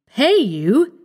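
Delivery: indignant, startled outburst; cried out in shock. A narrator's reading. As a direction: recoil at the very idea. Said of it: "he cried".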